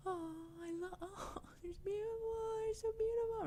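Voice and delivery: very high, soft, breathless gush; over-the-top swooning delight. Acted. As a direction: gush in a tiny high squeal. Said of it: "like, gushing".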